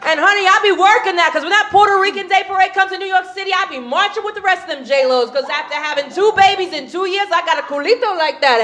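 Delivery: high-pitched, sassy Nuyorican boast; brash Puerto Rican woman. Acted. Puerto Rican marching with the J-Los, high sassy boasting. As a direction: brag like the star of the parade.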